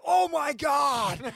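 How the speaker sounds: high, slow, drawn-out shout; imitating Kelly's yell. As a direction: belt it out like a yell across the lineup. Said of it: "Kelly just yelled out".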